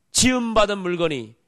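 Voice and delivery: normal talking voice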